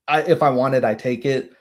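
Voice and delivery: normal talking voice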